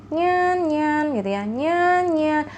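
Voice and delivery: high, cute meows; cat meow imitation. Shown as a demonstration. Teacher voices a cat for students, high cute meows. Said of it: "contohnya adalah nyan".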